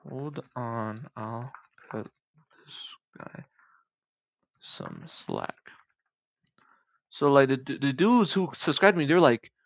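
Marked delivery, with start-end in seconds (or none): 0.1-5.6 very soft, halting, word by word; absorbed muttering. Real, not acted. Speaker working out the Russian translation, muttering. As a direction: mutter it to yourself with gaps between words.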